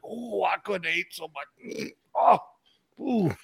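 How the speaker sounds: soft, groaning overfull granny; mock-groan after a huge meal; quietly moaning, too full to move; stuffed grandmother. Voicing an overfed grandmother on the couch.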